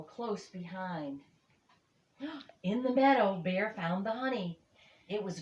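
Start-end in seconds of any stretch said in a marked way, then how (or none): 2.2-3.4 surprised gasp. A narrator's reading. Gasp at finding honey.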